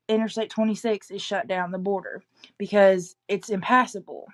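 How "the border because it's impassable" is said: ordinary delivery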